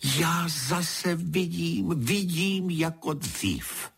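high, drawn-out cry of wonder; exclaimed cry. A narrator's reading. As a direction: cry out slowly, pitched far up, as sight returns. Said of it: "Paní vykřikla".

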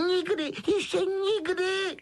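slow, very high drawn-out wail; Dobby wailing. A narrator's reading. Elf overwhelmed by Harry's kindness, wailing. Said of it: "kvílel".

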